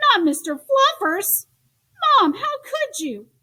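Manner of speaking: put-on high, loud childish dismay; a boy horrified his bunny might go; outraged protest. Child protesting toy's removal.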